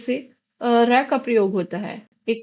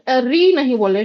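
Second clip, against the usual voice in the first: ordinary talk, nothing marked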